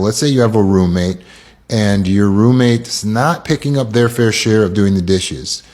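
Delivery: ordinary delivery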